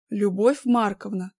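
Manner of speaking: ordinary delivery, nothing marked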